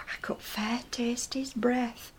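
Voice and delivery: slow scandalised whisper. Real, not acted. [name] confiding about the kiss, slow hushed whisper. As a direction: lean in and whisper it like a secret.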